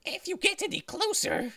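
high, menacing knife-wielding thug; menacing villain voice. Acted. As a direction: threaten her in a creepy villain voice. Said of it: "Oh, that's a pretty good voice".

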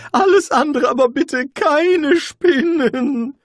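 high, loud, slow sobbing plea; terrified wizard wailing against the spiders. A narrator's reading. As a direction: beg through tears. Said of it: "jammerte Rincewind".

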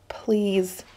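soft, tight-throated plea; whiny plea. Acted. Cornered kid begging bullies, small and whiny. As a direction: plead softly through a clenched throat. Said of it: "My voice squeaked through my tight and clenching throat, sounded high and whiny".

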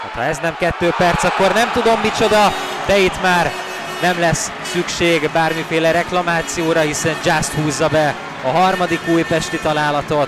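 high and loud, building to a goal; excited hungarian sports commentary. Real, not acted. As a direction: yell it live, can't believe it. Penalty then goal, live call.

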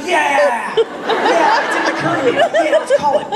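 high, loud, overeager yelling yes; mock excitement. Telling the store clerk he just realised, overjoyed. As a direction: ham up the sudden realisation.

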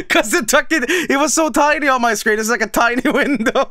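fast, high, giggling explanation; laughing. Real, not acted. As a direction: talk through leftover giggles. Laughing at own misreading.

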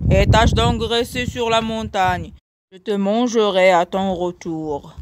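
plain reading voice

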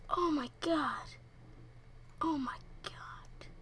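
soft, sinking to a slow whisper; hushed disbelief; stunned hush. Real, not acted. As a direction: breathe it out slowly, stunned. Reaction after the startled yell.

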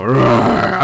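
loud mock roar; playful roar. Player cheers on his machine, playful roar. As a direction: let out a toy-monster roar.

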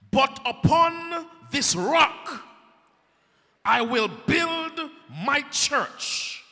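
preacher thundering the promise; preaching. Acted. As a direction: preach it to the rafters. Preacher proclaims Jesus' words.

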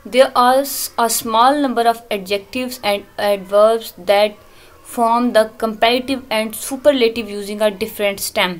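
very slow, spelled-out textbook reading; deliberate slow reading of a grammar rule; slow reading aloud. Shown as a demonstration. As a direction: read the rule line slowly to the class. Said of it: "reading textbook line slowly".

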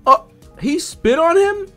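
normal talking voice

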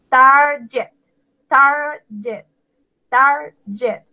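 "Target" stressed on the opening "Tar"; stress on first syllable. Shown as a demonstration. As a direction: split "target" in two, weight on "tar". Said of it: "sino la primera". ("target" con el acento en la primera sílaba, "tar")

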